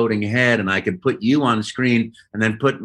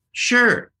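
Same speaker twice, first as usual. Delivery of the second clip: high eager sure; 12 semitones higher than usual. Retold or imitated. Agreeing to autograph.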